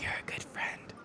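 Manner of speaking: soft whispered compliment; a hushed little thank-you to a friend. Real, not acted. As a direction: whisper it like a secret. Whispered aside to Jenny.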